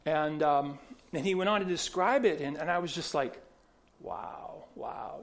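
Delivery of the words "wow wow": awed hush. Retold or imitated. Awed reaction to his conversion.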